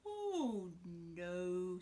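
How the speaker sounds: crocodile. Acted. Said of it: "said the crocodile".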